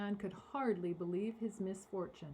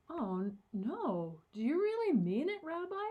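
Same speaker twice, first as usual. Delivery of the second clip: alarmed high plea; crying out; 7 semitones higher, 5 dB louder than usual. A narrator's reading. Man pleading with the rabbi, dismayed cry. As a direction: cry out in dismay, pitch rising. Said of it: "cried the man".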